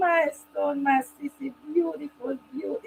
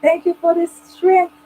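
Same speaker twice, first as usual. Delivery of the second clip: ordinary talk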